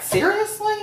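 disbelieving high squeak; incredulous. Retold or imitated. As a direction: say it like you can't believe it. Imagined cop's disbelieving reply.

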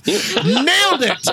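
self-satisfied quote. Retold or imitated. Bad accent judge proudly approving a terrible accent, self-satisfied. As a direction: crow it proudly, stretching it out. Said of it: "I'm always like".

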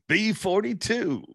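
slow drawn-out number call; bingo caller. Pretend. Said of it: "I think I'd be a good bingo caller".

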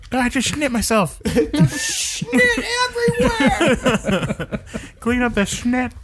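high giggly silly voice; laughing. Acted. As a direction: giggle through each line.

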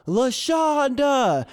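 high, slow drawn-out call; long high call of a name; yelling a name. Retold or imitated. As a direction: call her through the mail slot. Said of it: "we peek in and we yell".

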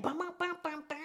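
high-pitched "bum bum" beat imitation; imitated house beat. Shown as a demonstration. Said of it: "You hear that house music?".

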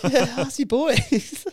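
high-pitched laughing retort; loud, high words broken by laughter. Real, not acted. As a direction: crack up while tossing it back. Laughing back at co-host's joke.

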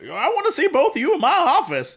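high-pitched, hammed-up summons; mock-stern high voice; stern boss, mocked. Boss summons pair in retelling.

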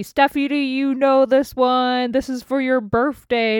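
a hint of a loud DJ calling out; lightly voiced bar host hollering; bar owner shouting. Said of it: "shouted out to me".

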